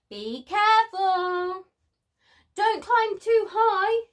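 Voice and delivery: high, loud warning call to a cub; lightly voiced cautious aunt. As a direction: warn the little one off the rock. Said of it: "an aunt warned".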